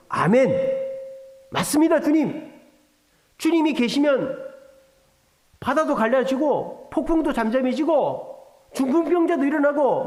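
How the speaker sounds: high, loud, ecstatic confession of faith; fervent believer. Pretend. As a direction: pour out belief like a woman sure of a miracle. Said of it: "그럼 마르다가 뭐라 해야 됩니까?".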